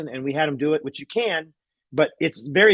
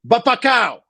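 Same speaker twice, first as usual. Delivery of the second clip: high, loud exclamation; loud shout; 9 semitones higher, 5 dB louder, slower than usual. Retold or imitated. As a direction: call it out so everyone hears.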